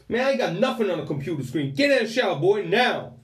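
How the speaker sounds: yelling prison officer. Retold or imitated. Officer losing patience with the new inmate, high loud shout. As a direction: lose patience and shout him into the shower. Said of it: "I do apologise for the accents".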